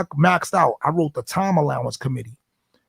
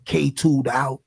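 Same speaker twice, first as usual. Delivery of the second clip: ordinary talk, nothing marked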